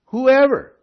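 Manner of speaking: "Whoever" punched high and loud; emphatic WHOEVER. Real, not acted. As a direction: hit "Whoever" hard as the answer. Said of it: "I'm just emphasizing this".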